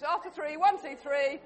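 usual speaking voice

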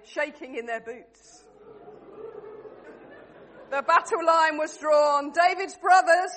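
loud, projected narrator opening a battle tale; story narration. A narrator's reading. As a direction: narrate grandly to a crowd, slowing at line ends. Narrates the play to the room.

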